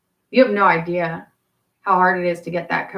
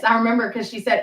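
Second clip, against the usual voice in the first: ordinary talk, nothing marked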